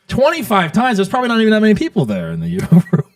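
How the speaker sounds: high-pitched disbelieving question; real astonishment, pitched well up; incredulous. Real, not acted. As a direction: react in amused disbelief, voice jumping up. Host reacts in disbelief to confession.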